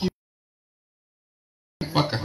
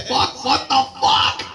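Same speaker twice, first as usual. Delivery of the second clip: disbelieving outburst; 8 semitones higher, 3 dB louder, slower than usual. Retold or imitated. Realizing noise was a booger.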